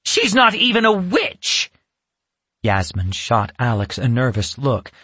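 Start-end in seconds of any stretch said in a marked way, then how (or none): 0.0-1.7 high scornful witch cry; scornful outburst. Acted. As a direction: spit it out in contempt. Furious witch glaring at Yasmin.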